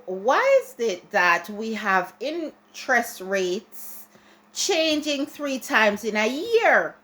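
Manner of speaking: usual speaking voice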